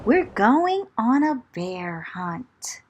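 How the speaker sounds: plain reading voice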